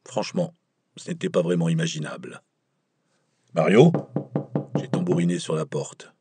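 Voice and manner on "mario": louder questioning call of the name. A narrator's reading. Myron calling Mario through the door, louder, uncertain.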